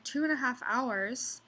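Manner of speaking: normal talking voice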